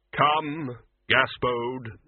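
very slow, grave Death voice. A narrator's reading. Death speaking.

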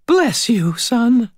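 high-pitched grateful blessing; grateful landlady. A narrator's reading. Landlady hugging her rescuer, high and grateful.

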